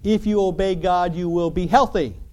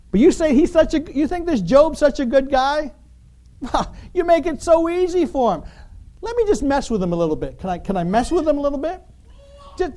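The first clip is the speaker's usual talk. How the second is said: much higher, louder sly Satan pleading; wheedling Satan; 9 semitones higher, 4 dB louder than usual. Retold or imitated. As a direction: coax God like a sly trickster, pitched way up. Satan voiced wheedling God.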